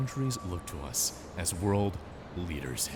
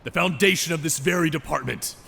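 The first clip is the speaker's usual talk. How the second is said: angry scolding; 5 semitones higher, 5 dB louder than usual. Acted. Boss scolds agents.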